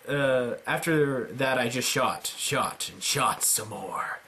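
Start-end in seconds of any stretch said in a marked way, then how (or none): none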